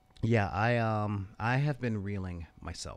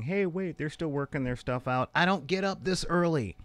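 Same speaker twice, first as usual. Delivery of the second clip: lightly voiced protest, pitched higher; mock complaining viewer; 7 semitones higher, 4 dB louder than usual. Host voices a viewer commenting on the unfinished show, mock complaining. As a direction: play an indignant viewer, building louder.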